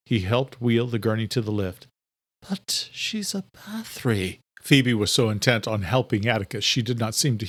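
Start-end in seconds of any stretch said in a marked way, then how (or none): 2.5-4.4 higher, softer and slow, full of disdain; shocked servant, softer; disdainful whisper. A narrator's reading. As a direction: sneer at the bloodline under her breath. Adela objects to Bathory.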